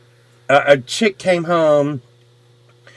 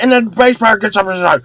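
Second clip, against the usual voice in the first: usual speaking voice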